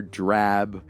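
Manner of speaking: ordinary delivery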